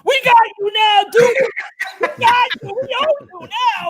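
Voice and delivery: high, gloating mock shout; men going nuts. Firefighters crowing at the boss who lost, going nuts. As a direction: crow loudly like a triumphant crew. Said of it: "the men went nuts".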